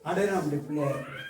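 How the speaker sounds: low, soft, quick prayer; addressed to God. Shown as a demonstration. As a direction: speak humbly to the Lord. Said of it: "கர்த்தரை நோக்கி". (ஆண்டவரிடம் தாழ்ந்த, மென்மையான குரலில்)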